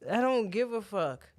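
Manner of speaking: ordinary talk, nothing marked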